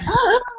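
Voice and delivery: sharp high gasp of alarm; startled gasp. Retold or imitated. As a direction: gasp in mock shock at the empty shore. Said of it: "and I'd be like".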